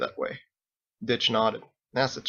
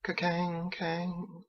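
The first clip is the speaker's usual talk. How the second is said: softer distant bell; faint bell toll; 6 semitones higher, 9 dB quieter, much slower than usual. Acted. Narrator imitating the church bell, fainter and distant. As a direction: let the bell fade far off.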